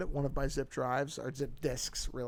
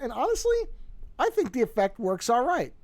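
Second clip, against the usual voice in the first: ordinary delivery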